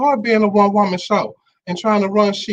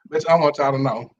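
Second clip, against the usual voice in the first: ordinary delivery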